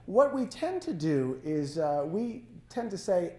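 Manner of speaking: usual speaking voice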